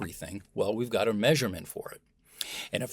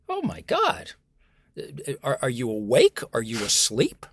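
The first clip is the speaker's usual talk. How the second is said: mock disbelief; 3 semitones higher, 5 dB louder than usual. Imagined interviewer asks a lifeless candidate, in mock disbelief. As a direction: act appalled at someone's lack of energy.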